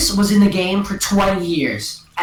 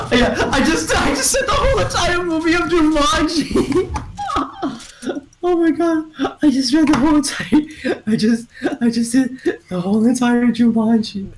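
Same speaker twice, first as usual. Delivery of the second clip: high, laughing through the words; giggling in disbelief at what she just did; real laughter; 9 semitones higher than usual. Real, not acted. Kid laughing to friends about retelling the whole movie.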